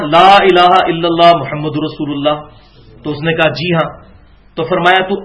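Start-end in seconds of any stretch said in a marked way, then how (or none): 0.1-2.4 slow recitation. Retold or imitated. Quoting the kalima as recited.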